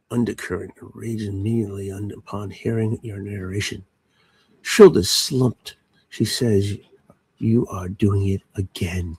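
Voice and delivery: low, slow noir narrator voice; hardboiled detective narration, slow and hushed. Pretend. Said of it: "It's fun to narrate your wife".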